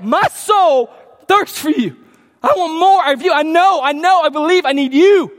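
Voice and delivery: acted-out yearning declaration of faith; fervent prayer. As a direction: pour it out to God with urgency. Acting the psalmist's impassioned prayer.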